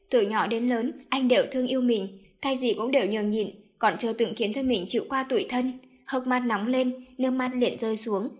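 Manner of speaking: ordinary delivery, nothing marked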